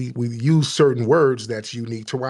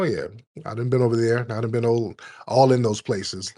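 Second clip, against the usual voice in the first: ordinary talk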